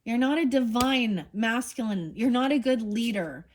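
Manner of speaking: real anger at the absent man; angry. Real, not acted. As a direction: scold him like you're fed up. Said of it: "I'm getting pissed".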